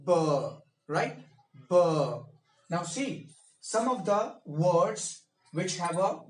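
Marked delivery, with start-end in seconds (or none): 0.1-0.1 "b" with lips pressed, then released with voice; b sound demo. Shown as a demonstration. 1.7-1.8 "B" with lips pressed and released; b sound demo. Shown as a demonstration.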